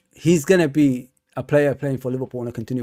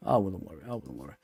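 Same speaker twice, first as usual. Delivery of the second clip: each repeat softer and lower until almost gone; fading reassurance; 11 semitones lower, 13 dB quieter, faster than usual. Real, not acted. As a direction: let it trail off into a near murmur.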